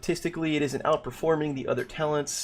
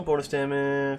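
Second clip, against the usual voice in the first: ordinary talk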